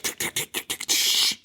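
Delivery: whispered tick-tick-tick of a sprinkler head. Retold or imitated. Describing the blood sprinkler, soft whispered ticking. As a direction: click it out softly like a rotating sprinkler. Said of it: "it's doing the, like, tick".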